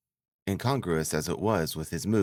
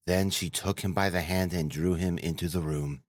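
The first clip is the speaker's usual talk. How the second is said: ordinary delivery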